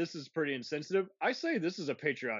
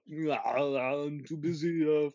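Arnold Schwarzenegger voice. Retold or imitated. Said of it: "if Arnold had".

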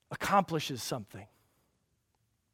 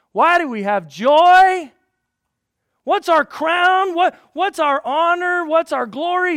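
much higher and louder, slow, ringing preacher's cry; climactic preaching; 12 semitones higher, 14 dB louder, slower than usual. Acted. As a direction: build each question to a peak.

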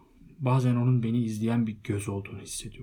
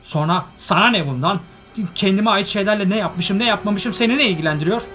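loud, high and indignant; angry outburst; 10 semitones higher, 12 dB louder than usual. A narrator's reading. Bilbo angrily refusing Gandalf over the ring. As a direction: snap back at the wizard, furious.

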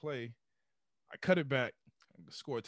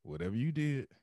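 raspy voice; 4 semitones lower, faster than usual. Retold or imitated. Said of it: "he got this real raspy voice".

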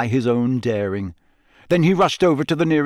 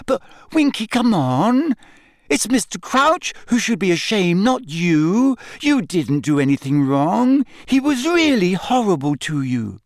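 put-on higher, pleading young girl; earnest young girl; 8 semitones higher than usual. A narrator's reading. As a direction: coax her like a kind girl. Hermione voiced.

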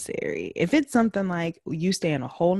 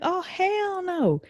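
acted indignation; 6 semitones higher than usual. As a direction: lay down the law, hammed-up. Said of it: "me, I'm like".

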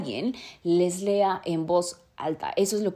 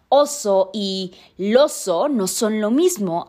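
oso and loso said slowly and louder to set them apart; slow careful contrast; 2 semitones higher, 6 dB louder, slower than usual. Shown as a demonstration. Comparing two look-alike words for the listener, slow careful contrast. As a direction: spell out oso against loso, slow and firm. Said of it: "aunque se leen de manera muy parecida".

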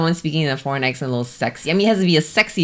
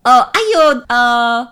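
high, loud caricature Chinese accent; 8 semitones higher, 6 dB louder than usual. Shown as a demonstration. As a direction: attempt an exaggerated mock-Chinese accent. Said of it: "Is, is that more Ching Chong?".

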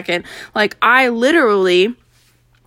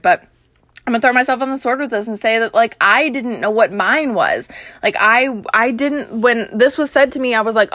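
ordinary delivery

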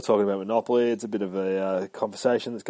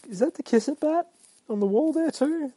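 excited surprise. Real, not acted. Spots a prop in the panel.

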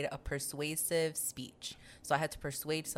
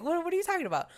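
baffled; 14 semitones higher, 4 dB louder, faster than usual. Retold or imitated.

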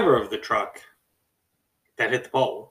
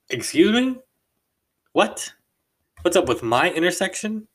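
much higher, louder rising disbelief; incredulous disbelief; 7 semitones higher, 3 dB louder than usual. Retold or imitated. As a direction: echo it back, stunned and offended. Stunned reply to rude accusation.

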